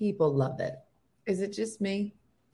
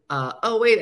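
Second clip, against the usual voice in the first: normal talking voice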